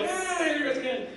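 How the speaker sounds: high-pitched retold wail; mock dismay. Robbed woman cries out as the thief strikes, in mock dismay. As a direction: imitate a victim's helpless cry. Robbed player wails in story.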